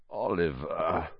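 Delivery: slow, soft sleepy croak. Acted. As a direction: croak the name half asleep. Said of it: "Harry croaked".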